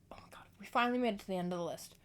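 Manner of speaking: usual speaking voice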